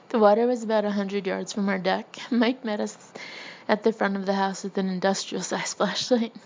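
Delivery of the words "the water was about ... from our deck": usual speaking voice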